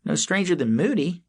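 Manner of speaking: talking through a mouthful of food; words round a bite of food; mouth full. A narrator's reading. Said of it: "spoke through a mouthful of food".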